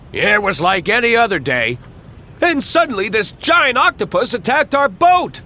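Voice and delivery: dramatic fisherman. Acted. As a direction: ham it up for the camera.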